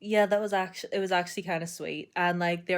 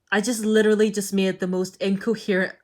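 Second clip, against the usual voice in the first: ordinary delivery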